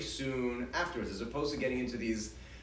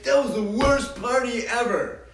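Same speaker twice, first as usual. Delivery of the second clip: higher, louder mock complaint from a kid; whiny complaint; 8 semitones higher, 9 dB louder than usual. Child complaining after a party.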